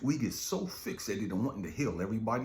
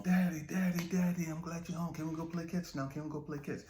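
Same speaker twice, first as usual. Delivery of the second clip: put-on high child's voice begging; eager little kid pleading to play; a small son begging his dad to play catch; little boy; 11 semitones higher than usual.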